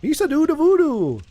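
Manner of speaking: silly high cartoon alien voice; goofy alien voice. Streamer jokes about Star Wars in a silly high alien voice. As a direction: play a bumbling alien sidekick.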